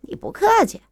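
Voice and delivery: sneering. Acted. Thug taunts the boyfriend, sneering fast. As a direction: brush off the threat with a sneer. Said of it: "冷笑着说".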